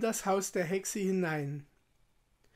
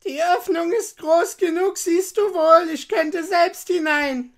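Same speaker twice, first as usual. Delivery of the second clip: high, very loud, exasperated witch; old witch; 10 semitones higher, 11 dB louder than usual. A narrator's reading. Witch showing Gretel the oven, exasperated shout. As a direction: scold loudly as a high-pitched witch. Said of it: "sagte die Alte".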